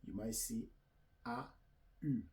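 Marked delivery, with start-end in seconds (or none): none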